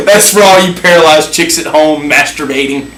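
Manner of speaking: helplessly amused sign-off; laughing hard. Real, not acted. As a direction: talk through real laughter. Cracking up after the punchline.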